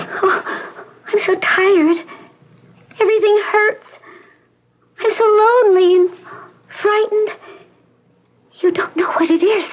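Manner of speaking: high, teary voice, close to crying; tearful frightened girl. Acted. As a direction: confess loneliness and fear. Patient tired and frightened.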